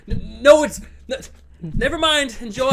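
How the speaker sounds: high, stammering retreat; flustered mock backpedal. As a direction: awkwardly drop the subject. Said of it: "I was like".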